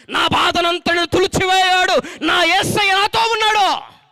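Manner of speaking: loud, slow triumphant cry; slow shouted proclamation. Acted. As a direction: lift the whole congregation. Preaching at full force. (బిగ్గరగా నెమ్మదిగా విజయ ప్రకటన)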